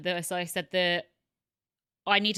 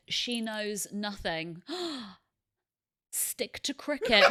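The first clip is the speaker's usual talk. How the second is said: mock comment reading; slower than usual. Reading out a hostile comment, laughing.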